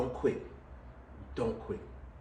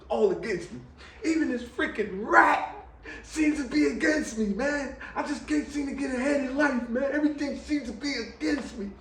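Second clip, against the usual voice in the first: half-laughing lament; 7 semitones higher than usual. Retold or imitated. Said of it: "'Cause I said".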